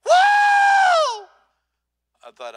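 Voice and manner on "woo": a loud, high whoop from the back of the plane; whooping shout. Retold or imitated. Preacher on the plane whooping for joy. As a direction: let out a big, joyful whoop. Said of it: "I hear this voice going".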